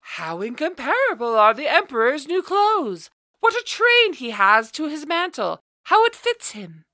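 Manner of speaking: high, excited crowd exclamations; astonished cries. A narrator's reading. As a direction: gush in amazement at the clothes. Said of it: "cries of astonishment".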